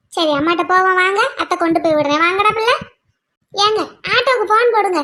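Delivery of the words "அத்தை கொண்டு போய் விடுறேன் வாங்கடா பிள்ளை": normal talking voice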